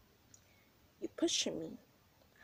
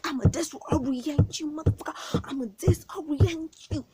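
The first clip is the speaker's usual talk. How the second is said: louder, higher threat; retold threat; 7 semitones higher, 6 dB louder than usual. Stomping on the girl's face, a louder higher threat. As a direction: snarl it while stomping. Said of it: "I was like".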